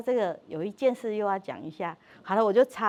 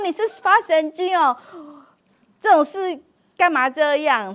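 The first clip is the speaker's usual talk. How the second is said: louder, higher exasperated cry; loud exasperated shout; 8 semitones higher, 10 dB louder than usual. Retold or imitated. Wife yelling at husband threatening to leave, loudly exasperated. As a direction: yell at him in disbelief. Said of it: "然后我就说".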